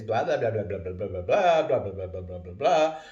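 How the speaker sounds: ex-wife's nagging mimicked in swinging blahs; lightly mocking a nagging ex; mock nagging rant. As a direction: rattle off a mocking nag, up and down. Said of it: "she started beaking off to the kids".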